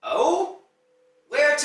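loud, high-pitched challenge; booming, high mock-curious retort; yelling. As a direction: throw the questions back loudly. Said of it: "he's yelling".

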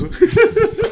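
sudden high-pitched burst of laughter. Real, not acted. Laughs at own joke.

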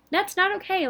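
high, emphatic objection; indignant. Retold or imitated. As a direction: push back hard on it. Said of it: "And I'm like".

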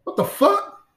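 a startled cry on seeing things move; alarmed what the fuck. Retold or imitated. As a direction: blurt it out in sudden alarm. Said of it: "I'm like".

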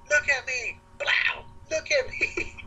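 high imitation of her demanding attention; frantic girlfriend. Girlfriend slapping him awake, retold.

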